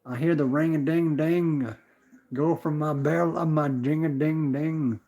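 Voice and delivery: normal talking voice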